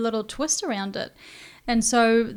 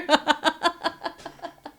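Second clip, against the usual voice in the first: higher giggling laugh; real laugh; 7 semitones higher than usual. Real, not acted. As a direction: laugh at your own tease. Host laughs after teasing.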